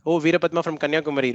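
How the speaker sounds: ordinary delivery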